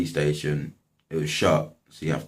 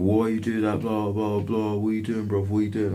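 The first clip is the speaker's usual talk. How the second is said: higher-pitched imitation of a frantic protest; mock mental rant; 5 semitones higher than usual. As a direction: rattle off his outraged complaint in a raised pitch. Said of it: "He went absolutely mental".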